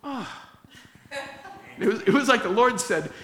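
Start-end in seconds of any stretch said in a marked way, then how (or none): 0.0-0.4 high, soft astonished "Ah"; stunned gasp. Retold or imitated. Counselor stunned that the prayer worked, soft high gasp. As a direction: caught off guard.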